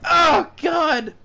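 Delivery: high, loud frightened pleading; panicked. Real, not acted. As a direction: beg it to stop in terror. Sanity drop scares him.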